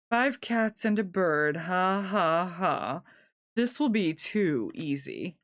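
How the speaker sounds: slow, drawn-out villain voice with a mocking laugh; gloating villain. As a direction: savor every word as the villain. Villain gloats in their heads.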